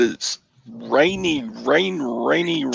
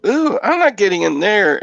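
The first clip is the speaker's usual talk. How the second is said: imitating wife; 6 semitones higher, 6 dB louder than usual. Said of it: "She would stick her foot in and go".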